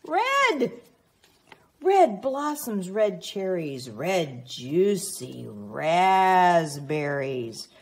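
storybook excitement; excited reveal. Acted. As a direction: announce each red thing with delight to a small child. Reading a picture book aloud.

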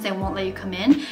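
usual speaking voice

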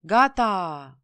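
loud, drawn-out call; sharp call. A narrator's reading. Conductor signalling departure on the platform, sharp call. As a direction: sing out that it's time to go. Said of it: "glasul ascuțit al conductorului".

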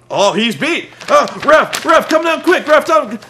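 high, loud mock urgency; frantic calling out. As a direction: yell excitedly for the referee, imitating a wrestler. Miz urgently calling the referee.

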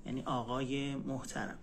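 normal talking voice

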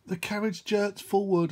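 plain reading voice

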